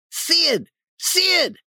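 put-on high-pitched cry of a boy calling out; urgent calling. Tom trying to wake sleeping Sid, urgent high call. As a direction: call his name very high to wake him.

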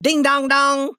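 high, loud bell imitation; bell noise. Ben imitates a riverboat bell, high and loud. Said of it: "shout again and say".

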